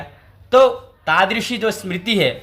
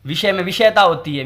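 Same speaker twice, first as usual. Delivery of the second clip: ordinary delivery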